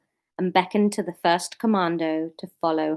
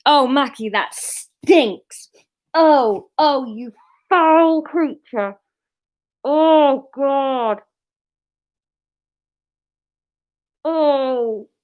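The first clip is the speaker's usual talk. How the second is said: mock disgust; 4 semitones higher, 5 dB louder, much slower than usual. Real, not acted. Dog farts during reading.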